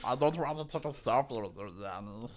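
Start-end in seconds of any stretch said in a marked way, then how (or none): none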